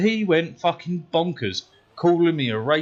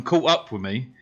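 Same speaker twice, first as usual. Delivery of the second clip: plain reading voice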